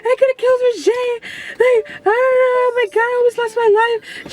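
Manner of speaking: high, whiny mock crying; imitated sobbing panic, very high-pitched; tearful wailing. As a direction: wail it as if bawling in retelling. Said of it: "So I just started crying".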